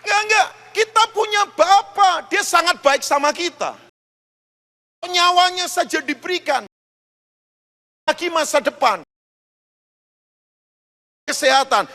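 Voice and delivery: loud, high, driving sermon climax; full-force preaching. Acted. Pastor urging the congregation, loud impassioned preaching. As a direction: preach it out, rising and hammering each line.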